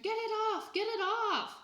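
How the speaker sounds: high, loud panicked scream; frantic toddler shriek. A narrator's reading. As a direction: scream it at the top of a small child's voice. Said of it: "Sam screamed".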